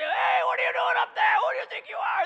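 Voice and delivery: loud, high, outraged yell; angry passerby shout. Retold or imitated. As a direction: yell up at the roof, furious. Angry people hit by gravel.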